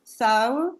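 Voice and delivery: normal talking voice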